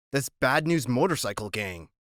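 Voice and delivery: ordinary delivery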